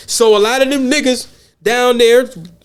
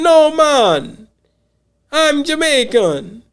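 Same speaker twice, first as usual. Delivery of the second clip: higher, drawn-out attempt at a Jamaican accent; 5 semitones higher, much slower than usual. Imitating a Jamaican answering whether he is African, attempted Jamaican accent. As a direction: put on a slow-ending, higher-pitched Jamaican accent. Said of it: "I'm trying to do the accent".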